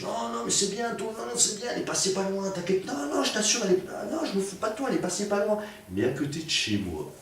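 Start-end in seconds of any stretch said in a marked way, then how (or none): 0.0-5.6 high mock-sweet voice, much higher; teasing fake reassurance. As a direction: pile on the teasing in a sugary falsetto. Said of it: "moi, pour en rajouter une couche".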